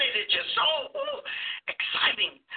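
a big swoop up on ooh, then dropping soft; gushing excitement. Retold or imitated. Preacher gushing to the congregation about a thrilling novel. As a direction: gush like a fan of a novel.

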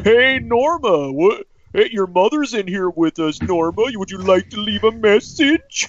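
high, slow campy demon voice; man doing a woman's voice, hammed up; cheesy demon voice. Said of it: "the demon voice".

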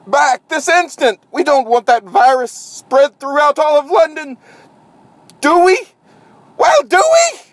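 shrill, loud, high frantic scolding; bossy scientist voice, high and loud; high-strung scientist. Acted. High-strung scientist berating staff.

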